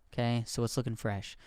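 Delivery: ordinary talk, nothing marked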